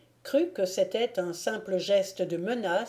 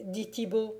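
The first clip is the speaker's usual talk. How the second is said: ordinary delivery, nothing marked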